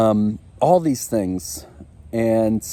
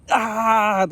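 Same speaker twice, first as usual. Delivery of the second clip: frustrated cry; 11 semitones higher than usual. Retold or imitated. Frustrated outburst at poop.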